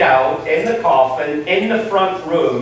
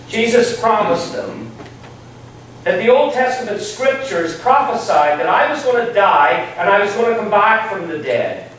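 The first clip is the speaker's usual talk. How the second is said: normal talking voice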